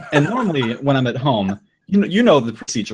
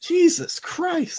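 much higher, slow, drawn-out exclamation; exasperated disbelief; 3 dB quieter, much slower than usual. Real, not acted. Reacting to the tiny result, slow and high in disbelief. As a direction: stretch it out in mock despair.

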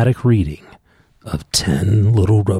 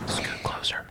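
whisper; 15 dB quieter, slower than usual. Real, not acted. Dad whispering instructions.

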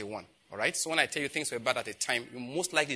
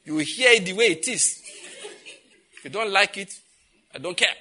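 loud, raised-pitch mock house rules; mock harassing husband; 6 semitones higher, 6 dB louder than usual. Preacher imitating a husband harassing his wife, loud mock bossing. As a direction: lay down the law like a bossy husband, in jest. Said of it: "don't go home and be harassing your wife".

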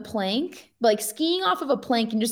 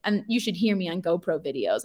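normal talking voice

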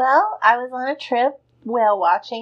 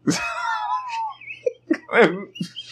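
high breathless laughter; breathless giggle; 3 dB quieter than usual. Real, not acted. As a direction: crack up laughing. Laughing after whale voice.

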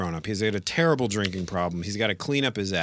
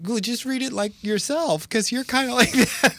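high camp director; 4 dB louder than usual. Retold or imitated. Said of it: "And they're like".

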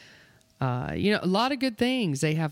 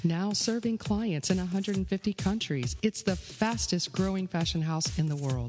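ad read; 3 semitones lower, 3 dB quieter than usual. Acted. Scripted ad read, several voices.

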